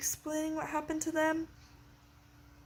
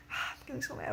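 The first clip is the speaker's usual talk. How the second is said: sighing; 9 dB quieter than usual. Real, not acted. Frustrated about the book.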